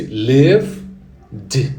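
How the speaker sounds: "lived" said very slowly, ending on a silent D; "lived" slow, spelled out for students; slow model pronunciation. Shown as a demonstration. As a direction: stretch "lived" out slowly and stop on the D. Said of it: "no final, D mudo".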